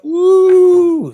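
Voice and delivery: high, very loud squeal of delight; squealing ooh. Real, not acted. Streamer greeting a good-looking viewer, squealing.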